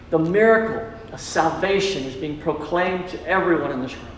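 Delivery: ordinary talk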